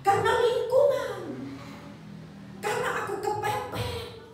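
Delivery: high, loud pleading excuses; whiny excuses. Acted. Voicing a sinner's excuses to God.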